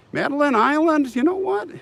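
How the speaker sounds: sudden high-pitched thrill at a close guess; excited squeal. Real, not acted. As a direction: squeal it, the guess nearly hits.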